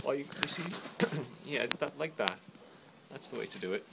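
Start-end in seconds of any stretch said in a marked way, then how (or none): none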